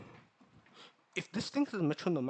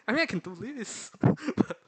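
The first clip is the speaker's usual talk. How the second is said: laughing; 9 semitones higher, 7 dB louder than usual. Real, not acted. Embarrassed laugh over struggling.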